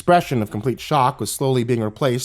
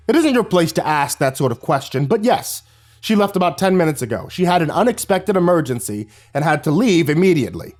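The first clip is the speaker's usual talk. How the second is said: higher, curt annoyed principal; snapped; 6 semitones higher than usual. Acted. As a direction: snap back at her. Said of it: "glowered at her and snapped".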